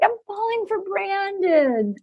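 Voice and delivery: hammed-up love confession; mock gushing. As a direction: stretch it into a swooning declaration. Mimics Mel's gushing declaration.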